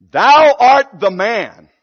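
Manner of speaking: very slow, loud, weighty accusation; solemn accusation. Retold or imitated. Nathan accusing David to his face. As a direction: press every word. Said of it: "And Nathan said".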